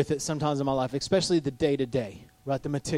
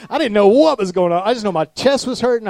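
louder, higher, worked-up retelling; animated; 6 semitones higher, 8 dB louder than usual. Real, not acted. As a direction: relive the panic with energy.

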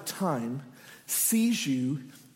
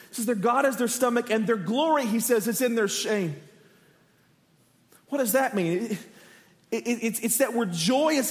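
ordinary delivery

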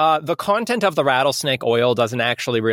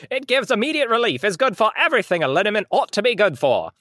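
higher-pitched vintage pitchman; old school announcer; 5 semitones higher than usual. Acted. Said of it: "old school announcer voice".

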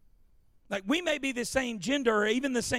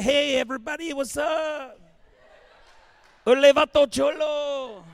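hammed-up Cajun Mexican accent, higher and louder; 4 semitones higher, 5 dB louder than usual. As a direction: ham up a Cajun Mexican greeting. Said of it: "That's a Cajun Mexican".

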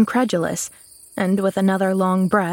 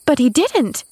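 put-on higher girl's protest; protesting cry; 7 semitones higher than usual. A narrator's reading. Girl contradicting the excited maid, protesting. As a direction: cry out a correction as Pollyanna. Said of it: "cried Pollyanna".

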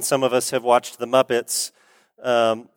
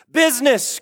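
sharp high outcry on Business; cried out; 10 semitones higher than usual. A narrator's reading. Marley's ghost crying out to Scrooge, a high cry. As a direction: fling the word up like a ghost's cry. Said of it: "cried the ghost".

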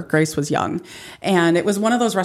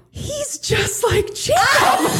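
much higher, slow, excited squeal; excited kid; much slower than usual. Retold or imitated. Mom imitating daughter's excited discovery, high squeal. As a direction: squeal it like a thrilled third grader. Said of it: "Grace looks at me and she goes".

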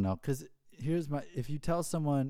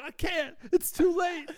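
much higher hammed-up sinister reply; mock doom. Friend taunting his passenger before the crash, high hammed-up mock doom. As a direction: play the gleeful villain.

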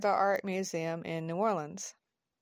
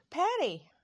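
high-pitched call to a friend; 10 semitones higher than usual. A narrator's reading. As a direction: call the name out, without a full shout. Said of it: "calls out".

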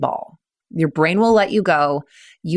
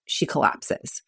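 whispered drop; 5 semitones lower, 8 dB quieter, slower than usual. Real, not acted. Story climax, voice drops.

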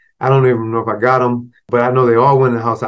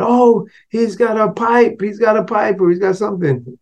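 put-on kids yelling; 9 semitones higher than usual. Playground kids warn each other, high excited calls. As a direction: shout like kids spotting trouble. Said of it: "like, yelling".

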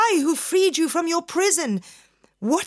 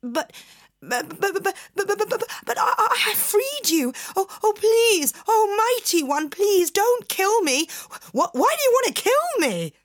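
high, stammering plea; terrified fisherman; 11 semitones higher, faster than usual. Acted. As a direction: beg for your life.

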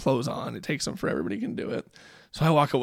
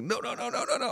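put-on high, soft whiny child refusing; imitating a child's tantrum; 9 semitones higher, 7 dB quieter than usual. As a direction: mimic a small boy's frightened refusal. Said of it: "throw a fit".

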